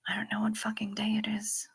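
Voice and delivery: hushed whispered curse. Real, not acted. Streamer hiding a curse from her mom, whispered. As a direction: whisper the swear so chat barely hears.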